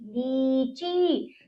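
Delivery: लीची very slow, both ई held long; drawn-out vowels. Shown as a demonstration. Teacher models long vowels for children, drawn out. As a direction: stretch each syllable of लीची. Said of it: "हम इसको खींचेंगे".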